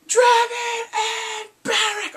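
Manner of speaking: high, loud, drawn-out shriek; excited scream. Acted. Fan learning favourite characters survived, excited scream. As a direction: shriek the good news in triumph.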